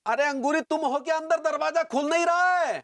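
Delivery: high, urgent call through a door. Acted. Calling through a stuck door. (ऊँची आवाज़ में पुकारते हुए)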